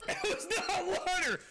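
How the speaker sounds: high shriek of shock; real high-pitched outraged scream. Real, not acted. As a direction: scream out that you were tricked. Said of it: "That scream just made Summer jump".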